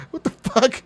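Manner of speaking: bewildered and cracking up; laughing confusion. Retold or imitated. Confused by the game menu.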